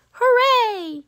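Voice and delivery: high, bright shout of joy; hooray cheer. A narrator's reading. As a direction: celebrate out loud. Celebrating her skills applauded.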